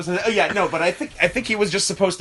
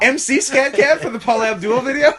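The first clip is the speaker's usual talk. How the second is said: laughing; 9 semitones higher, 6 dB louder than usual. Real, not acted. Excited laughing question.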